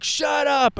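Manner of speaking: high-pitched imitated shout; mock yell. Guys yelling at the dog, imitated high shout. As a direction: yell it high, as overheard through a door.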